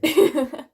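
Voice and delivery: loud sudden laugh; burst of laughter. Real, not acted. Excited about dad's new truck.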